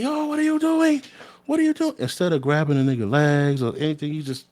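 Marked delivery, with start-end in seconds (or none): 0.0-1.9 much higher, frantic mock yelling; hammed-up freaked-out guard shrieking; panicked officer. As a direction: imitate the officer losing it in a squeaky panic. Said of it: "screaming at him".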